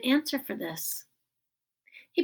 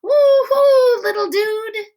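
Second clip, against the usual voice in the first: much higher, much louder whoop; shouting through hands; 10 semitones higher, 9 dB louder, slower than usual. A narrator's reading. Amos cheering Augie through cupped hands, loud high whoop. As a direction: whoop across the hall. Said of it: "Amos was shouting through his hands".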